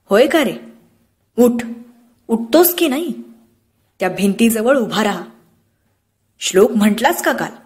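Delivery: a stern, angry demand from the father. Acted. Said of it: "रागाने म्हटले".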